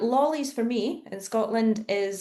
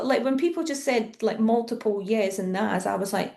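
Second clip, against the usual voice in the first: normal talking voice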